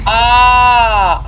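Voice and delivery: slow startled shout. Real, not acted. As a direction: yell from sudden fright. Jump scare while reading.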